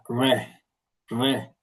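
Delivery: Ré with the r rolled on the tip of the tongue; Ré with a trilled tongue-tip r. Shown as a demonstration. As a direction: roll the r in Ré. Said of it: "se prononce roulé à la pointe de la langue".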